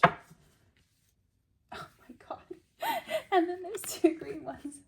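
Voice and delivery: helpless laughter, voice jumping high; real laughing. Real, not acted. Reader amazed by two green cards, cracking up. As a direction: squeeze the words out through laughter.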